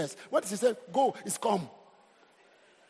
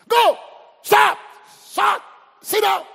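barked drill orders, much louder and higher; army commands; 11 semitones higher, 13 dB louder, slower than usual. Retold or imitated. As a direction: yell like a drill sergeant at recruits. Army drill commands shouted at boys.

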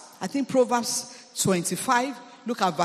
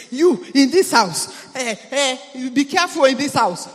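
mock scolding; 3 semitones higher, 8 dB louder than usual. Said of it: "and you start to say".

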